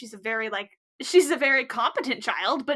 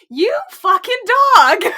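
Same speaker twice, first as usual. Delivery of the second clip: high, loud, slow mock outrage; slow loud playful rebuke; laughing scold; 8 semitones higher, 11 dB louder, slower than usual. Real, not acted. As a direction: call him out, half scandalized half amused. Scolding lecherous old man.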